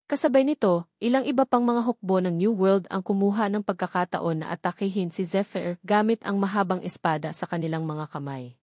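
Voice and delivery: plain reading voice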